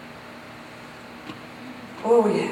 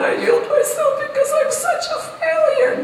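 high, loud mock whine with a laugh; mock self-pitying whine; 3 dB louder than usual. Shown as a demonstration. Preacher mocks self-pitying prayer, hammed-up whine. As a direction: pity yourself in a hammed-up wail.

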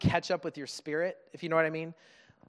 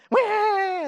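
put-on high, loud baby cry; imitated baby wail; 10 dB louder than usual. The baby wakes up and wails, very high and loud. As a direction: wail like a newborn.

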